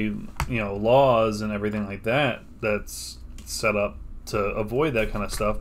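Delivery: usual speaking voice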